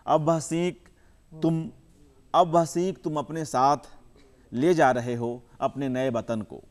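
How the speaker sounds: measured recital with long pauses between phrases; poem recitation. Acted. Poet reciting his poem to the audience, measured and unhurried. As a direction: savour each image, let the lines breathe.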